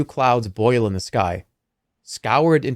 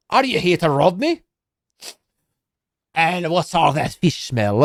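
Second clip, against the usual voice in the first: suspicious dwarf, higher, in a bad Scottish accent; 5 semitones higher than usual. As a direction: accuse the stranger in a put-on Scottish brogue. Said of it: "that's a bad Scottish accent".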